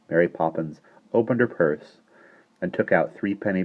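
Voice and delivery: ordinary delivery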